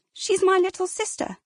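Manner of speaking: high, breathless shocked gasp. Acted. Girl kneeling by her sister tells crowd, high gasping shock. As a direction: get it out between shocked breaths. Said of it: "Adele gasped".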